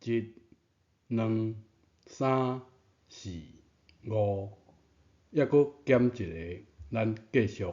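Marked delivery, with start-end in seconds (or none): none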